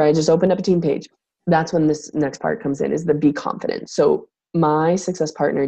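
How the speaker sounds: normal talking voice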